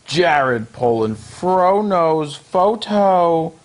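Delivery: drawn-out host outro; slow sign-off. Acted. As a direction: stretch the brand name out. Channel sign-off.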